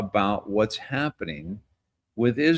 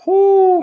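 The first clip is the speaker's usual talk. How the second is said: amused whoop; 12 dB louder than usual. Real, not acted. Amused by mocking post.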